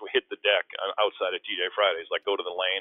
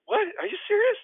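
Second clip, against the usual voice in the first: very high, louder disbelieving squeal; disbelief; 5 dB louder than usual. Retold or imitated. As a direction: squeak it out in shock. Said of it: "And I go".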